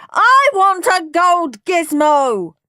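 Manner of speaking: slow, loud, high tantrum demand; howling. A narrator's reading. Henry to Mum over the gold gizmo, howling. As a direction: bawl out each word. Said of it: "howled Henry".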